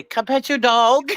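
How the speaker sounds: high, slow teasing singsong; teasing quote. Pretend. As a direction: tease her sweetly with it. Friend suggests playful line to Betty.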